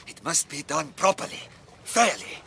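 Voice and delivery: usual speaking voice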